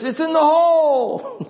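high, drawn-out celebratory holler; imitated yell. Golfer yelling the news back to the fairway, jubilant yell. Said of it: "like this. And he threw his arms in the air and he was yelling".

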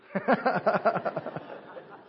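hearty burst of laughter. Real, not acted. Joking about his lane, laughs.

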